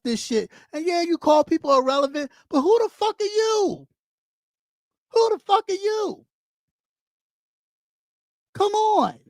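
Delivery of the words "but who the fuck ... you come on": high, exasperated dismissal; real indignation, high then falling away; indignant challenge. Real, not acted. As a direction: challenge them with pitch jumping up. Challenging the people being ranted at.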